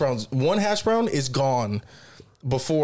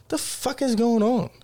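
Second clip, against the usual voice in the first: mock baffled; 8 semitones higher than usual. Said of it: "I'm like".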